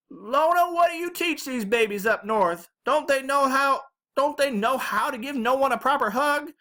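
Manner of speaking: put-on high, loud old-woman holler; scolding Southern grandma. A narrator's reading. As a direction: scold them as a feisty Southern granny. Grandma loudly scolds on greeting.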